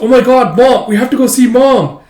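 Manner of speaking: very high, loud burst; sudden eager urgency; retold thrilled outburst to a father; acted daughter's excitement. Daughter excitedly remembers her mother.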